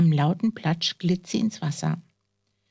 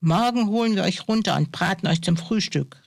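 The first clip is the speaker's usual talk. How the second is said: usual speaking voice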